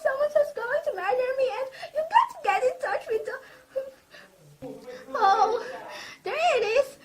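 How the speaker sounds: woman alone in the house begging the operator to save her; terrified tearful begging. Acted. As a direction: beg through tears as panic takes over.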